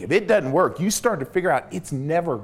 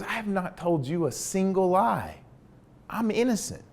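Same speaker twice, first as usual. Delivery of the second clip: higher, softer insistence of innocence; insistent prisoner; 5 semitones higher, 4 dB quieter than usual. Retold or imitated. Prisoner swearing to his interrogator, high and soft. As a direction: plead it high and quiet.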